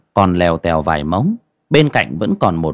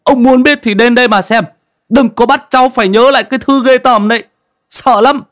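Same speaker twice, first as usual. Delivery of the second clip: much higher and louder frightened yell; shouting; 7 dB louder than usual. A narrator's reading. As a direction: snap back in terror and refuse to remember. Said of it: "quát lên". (thằng Ca Rang quát lên trong sợ hãi)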